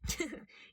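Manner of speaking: high burst of laughter; the detective laughing at the thief; giggly laugh. A narrator's reading. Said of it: "then laughing".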